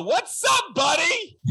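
excited shouted hello; hyped shout. Real, not acted. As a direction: yell it out to greet your buddy. Hyped greeting to new guest.

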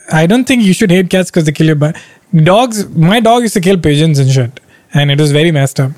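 ordinary delivery

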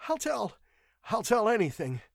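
high, desperate surrender; pitiful, panicked begging; moaning victim. A narrator's reading. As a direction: give in, pleading in a high voice. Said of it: "moaned the victim".

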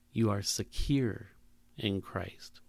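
slow, soft and low, trailing off; gentle reassurance. Pretend. God answering fear, soft and slow. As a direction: settle the fear with calm. Voicing God's tender reply.

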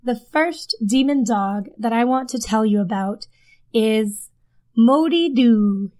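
ordinary delivery

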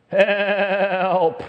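high, loud bleating cry of "Help!"; sheep impression. Sheep stuck in a hole bleats for help in a high, loud bleat. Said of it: "It's my sheep impression".

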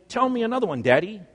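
put-on eager child's plea. Child begging dad for another bedtime story, eager and high. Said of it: "and then I would exclaim".

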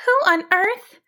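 high, puzzled sheep voice; surprised and confused. A narrator's reading. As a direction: trail off in high-pitched bewilderment. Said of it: "Surprised and confused".

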